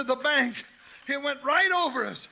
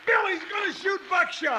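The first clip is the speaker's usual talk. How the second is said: higher, urgent yell; warning shout; 7 semitones higher than usual. Acted. Warning shout, gun drawn.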